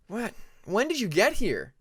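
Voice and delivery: high, loud eager question; bursting to know; barely held excitement. A narrator's reading. Friend asking his visitor, eager and loud. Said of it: "trying to keep the excitement in check".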